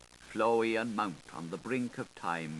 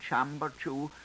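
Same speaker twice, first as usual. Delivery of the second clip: ordinary delivery, nothing marked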